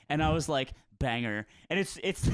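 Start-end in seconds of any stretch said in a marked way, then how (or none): 1.0-1.4 dry deadpan. Retold or imitated. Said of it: "it's so dry".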